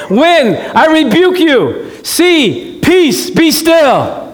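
slow, high, ringing command to the storm; commanding Jesus. Acted. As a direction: rebuke the storm grandly. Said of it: "we're gonna enact this".

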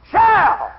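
Shall hit alone, high and loud, falling; a lone emphatic Shall, high and falling; stress on Shall. Real, not acted. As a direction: punch Shall as its own word. Repeats key word of the verse.

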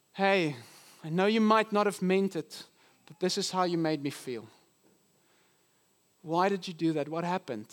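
tender, restrained appeal; gentle confrontation. Acted. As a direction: gently open up without accusing. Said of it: "when I gently restore others".

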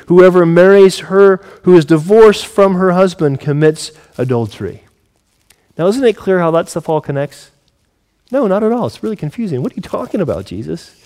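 ordinary talk, nothing marked

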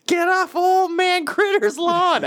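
put-on slow, high cranky old man; grumpy old man. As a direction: shoo kids off the lawn. Grumpy old man yelling at kids.

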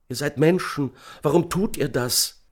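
high-pitched desperate appeal; anguished high voice, restrained volume; pleading cry. A narrator's reading. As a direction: beg them to see reason. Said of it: "schrie die Stimme des einen Mannes".

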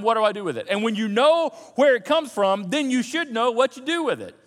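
high, loud, driving preacher voice; full-force preaching. Acted. Preacher urging the congregation to direct their thoughts, full-force preaching. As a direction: press the point hard to the congregation.

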